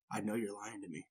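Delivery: low, quiet mock-mom warning; hushed, serious accusation; real serious. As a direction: drop low and soft, an imitated stern parent. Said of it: "Gets real serious with you".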